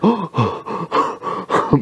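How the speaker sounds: high, repeated gasps; mock gasping for air. Waitress up from the fountain, mock gasping. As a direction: gasp as if surfacing from water. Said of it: "breathing 'cause she just dunked".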